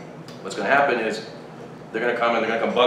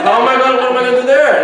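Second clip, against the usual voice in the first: high, loud laughing protest; mock helplessness with a laugh; exasperated retort; 11 semitones higher, 7 dB louder, faster than usual. Acted exasperation at her list.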